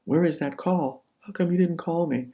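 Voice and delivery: a hint of a sulking, wounded boy; wounded schoolboy. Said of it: "like a little wounded schoolboy".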